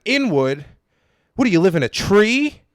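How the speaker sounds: high, loud jeering rant; comic mocking a heckler at the top of his voice; mock insulting yell. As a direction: roast him at full volume. Comic insulting an audience member.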